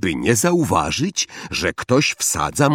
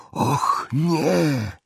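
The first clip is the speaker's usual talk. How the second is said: high, stammered gasp of dismay; wyjąkane ze strachu; 6 semitones higher than usual. A narrator's reading. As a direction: stutter it out, shocked and scared. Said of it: "wyjąkał Ron". (jąkając się z przerażenia)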